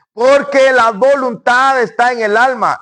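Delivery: high, drawn-out know-it-all objection; mock objection. Listener correcting the preacher on the soul, mock objection.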